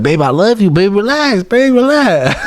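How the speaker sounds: high, pleading mock coaxing; pleading boyfriend. Man begs his girlfriend not to call police, in high mock pleading. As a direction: coax her to calm down, pitched high.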